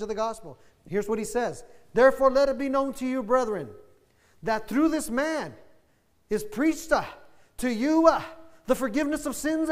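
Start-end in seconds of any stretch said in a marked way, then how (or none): 1.9-9.7 preaching the scripture. Acted. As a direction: proclaim it to the congregation. Pastor reads Acts aloud.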